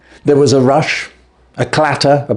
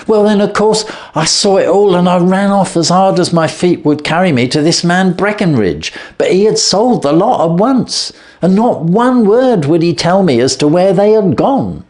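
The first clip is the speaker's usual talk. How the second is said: higher, urgent, pressing on; frantic telling; 9 semitones higher than usual. A narrator's reading. Thief confessing to Holmes how he chased the geese, frantic. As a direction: race through it as if still running.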